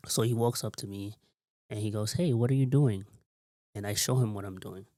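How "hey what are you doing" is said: louder supervisor challenge; calling out sharply. Retold or imitated. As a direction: call out to question a worker. Supervisor calls out to him.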